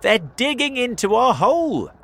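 high, loud urgent yell; alarmed shout. A narrator's reading. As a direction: raise the alarm at full voice. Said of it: "he shouted".

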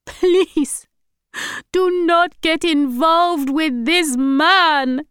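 high, loud, acted crying plea; tearful pleading. A narrator's reading. Grandmother begging granddaughter on the phone, acted tearful. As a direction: drag the words out in a high wail. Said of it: "Then she started to cry".

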